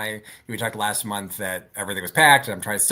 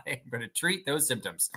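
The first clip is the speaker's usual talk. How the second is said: ordinary delivery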